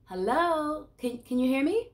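loud, high call to check the line. Real, not acted. First-time client checking the therapist can hear, calling out. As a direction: raise your voice to be heard.

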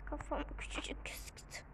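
breathy whispered aside. Real, not acted. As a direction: whisper it to yourself. Muttered aside while playing.

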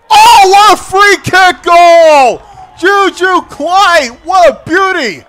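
long, loud stretched goal yell; excited goal call. Real, not acted. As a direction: drag out the goal cry. Free kick goal.

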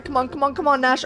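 usual speaking voice

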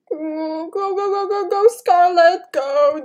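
high, loud frantic chant; frantic urging. Real, not acted. Player urging Scarlet to run, frantic. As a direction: urge yourself on in a panic. Urges own character to flee.

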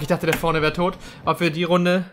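ordinary talk, nothing marked

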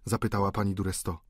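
ordinary delivery, nothing marked

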